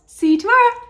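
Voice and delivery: bright, high and loud goodbye; cheery sign-off. Real, not acted. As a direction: sing out the farewell.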